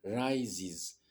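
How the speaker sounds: "Rises" said RYE-ziz, long I, buzzing z sounds; "Rises" with an English eye sound and two z sounds; teacher models "Rises" as rye-ziz; English word rises. Shown as a demonstration. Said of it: "ce mot-là est prononcé rises".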